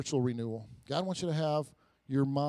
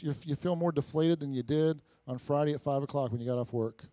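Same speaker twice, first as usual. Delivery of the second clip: normal talking voice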